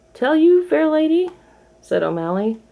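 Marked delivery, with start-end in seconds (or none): 0.1-1.3 high, loud flirtatious cat; charming alley cat. A narrator's reading. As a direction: sweep her off her feet. O'Malley flirts with Duchess.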